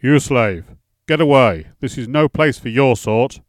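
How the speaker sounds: loud angry shout at a slave; furious lord driving someone away. A narrator's reading. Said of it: "he shouted".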